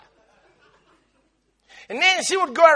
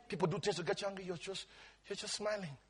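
much softer, hushed aside; 5 semitones lower, 22 dB quieter, faster than usual. Real, not acted. Preacher tells the church quietly, nearly whispered. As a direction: drop almost to a whisper.

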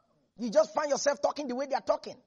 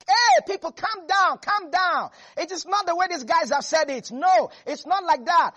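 calling out to crowd; 5 semitones higher, 5 dB louder than usual. Retold or imitated. Caleb calms the crowd, loud.

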